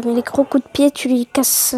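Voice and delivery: ordinary talk, nothing marked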